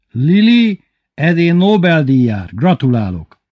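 high, loud congratulation; effusive praise. A narrator's reading. Father congratulating Lili on her cooking, effusive praise. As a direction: hail the cook as a prizewinner.

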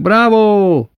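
high, loud, long-held shout; drawn-out yell of applause; yelled cheer. A narrator's reading. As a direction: cheer like a roaring audience. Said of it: "ordították".

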